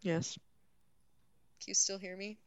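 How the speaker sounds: muffled, hand over the mic. Real, not acted. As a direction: talk through a hand covering the mic. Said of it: "Now you're covering the mic with your hand".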